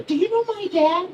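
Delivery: put-on very high, inquisitive kid voice; Tommy. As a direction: imitate a nosy little boy. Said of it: "one of the kids' name's Tommy".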